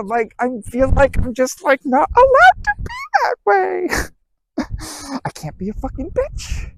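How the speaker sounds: high, crying whiny voice breaking into a laugh; mock whine. Mocking a listener who thinks anger is not allowed, high whiny cry. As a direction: mimic a self-pitying person who feels stuck.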